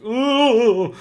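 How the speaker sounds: hammed-up impressed coo; mock clueless gasp. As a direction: parody a baffled onlooker's impressed coo.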